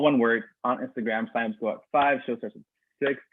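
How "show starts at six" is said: normal talking voice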